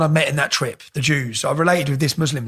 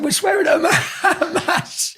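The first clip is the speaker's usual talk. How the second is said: laughing; 11 semitones higher, slower than usual. Retold or imitated. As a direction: laugh through the confession. Laughing while quoting himself.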